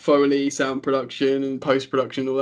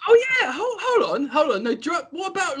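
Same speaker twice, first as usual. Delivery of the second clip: excited; 12 semitones higher than usual. Real, not acted. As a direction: jump in, bursting with the idea. Sudden excited interruption.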